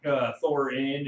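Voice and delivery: ordinary talk, nothing marked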